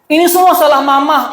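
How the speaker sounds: wife's high, loud blaming voice; mock angry wife. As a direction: play the wife pointing the finger, half laughing. Acted couple quarrel, wife blaming.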